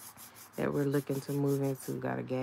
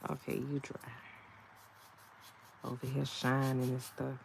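ordinary delivery

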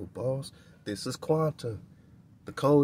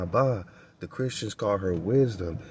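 ordinary delivery

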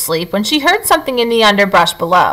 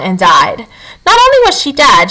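usual speaking voice

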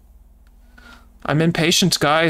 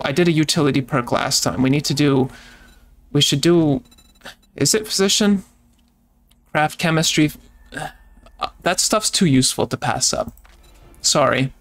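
usual speaking voice

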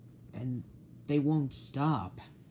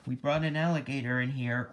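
usual speaking voice

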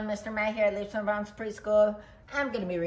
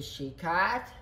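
usual speaking voice

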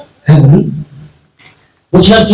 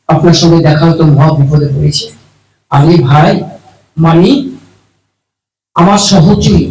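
ordinary talk

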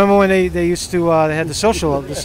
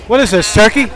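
loud, higher question to a vendor; raised-voice asking; calling out; 4 semitones higher, 6 dB louder than usual. Real, not acted. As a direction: call it out over the street noise. Asking vendor over street noise.

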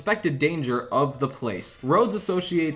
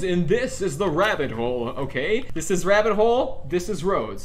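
ordinary talk

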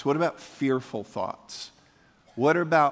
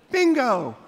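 high, bright announcer cry of bingo; mock game show announcer yelling bingo; game show shout; 9 semitones higher than usual. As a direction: call it out like a game show host.

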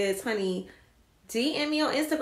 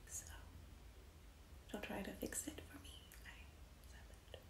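whisper; 28 dB quieter, faster than usual. Real, not acted. Whispered aside to viewers.

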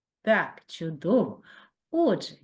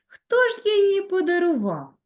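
curious voice; 11 semitones higher, 5 dB louder than usual. Acted. Asking question in poem.

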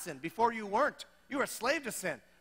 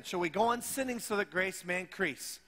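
ordinary delivery

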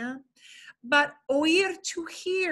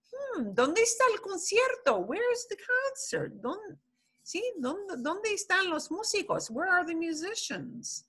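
higher, wondering voice looking for the music; a lightly voiced curious passerby; curious park walker; 7 semitones higher than usual. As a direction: play someone searching for a distant concert. Acting a park walker hearing music.

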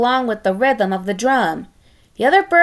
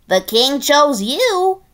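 higher, slow sing-song; mild teasing; 5 semitones higher, 3 dB louder than usual. Acted. Other pigs reminding Tiny Pig, slow sing-song tease. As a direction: lightly taunt him.

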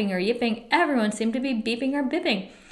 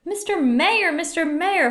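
high urgent call, drawing attention; 7 semitones higher than usual. A narrator's reading. Horton calls down to the mayor, urgently. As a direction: call to someone far below. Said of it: "Horton called".

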